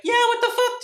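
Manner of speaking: high-pitched mock outrage; indignant echo. Passenger scolding the self-driving car, high indignant squeal. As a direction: squeak it in shared disbelief.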